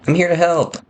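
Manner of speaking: put-on high-pitched pleading kid voice; eager child. Child telling his Da he can help, eager. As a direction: pipe up like a keen youngster.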